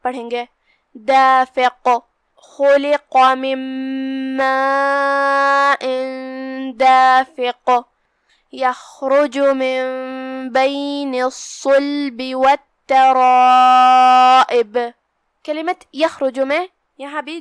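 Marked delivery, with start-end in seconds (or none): 0.9-1.9 دافق ending with a heavy, bounced qaaf; qalqala on qaaf. Shown as a demonstration. Teacher demonstrating qalqala on the final qaaf. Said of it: "قاف پہ رکیں گے تو اسے ہلاتے ہوئے". 2.6-14.8 slow, drawn-out chanted recitation; Quran recitation. Acted. Teacher opening the tajweed lesson, slow chanted recitation.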